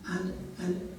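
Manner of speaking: ordinary delivery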